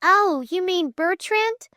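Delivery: put-on high, flustered girl's question; blushing. A narrator's reading. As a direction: ask it embarrassed, pitched high. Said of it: "blushed Flame".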